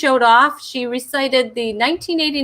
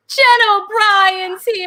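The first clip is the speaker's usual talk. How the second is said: higher and slow, stretched like a hype announcer; big host intro; 9 semitones higher, 4 dB louder, slower than usual. Acted. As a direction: sell her entrance to the crowd with a cry. Host calling out guest's entrance.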